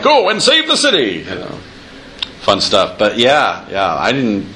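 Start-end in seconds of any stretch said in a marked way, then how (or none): 0.0-1.2 high, hammy arcade hero; heroic game voice. Shown as a demonstration. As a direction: call it out like a cheesy hero. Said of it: "But you're like".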